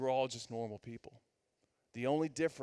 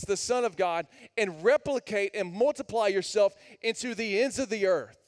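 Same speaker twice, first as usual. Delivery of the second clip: louder and higher, driving sermon voice; full-force preaching; 5 semitones higher, 7 dB louder than usual. Acted. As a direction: preach it hard to the room.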